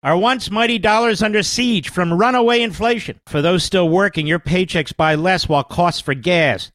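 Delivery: loud, punchy sales pitch; ad read. Acted. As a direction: sell it hard to listeners. Reads a sponsor ad.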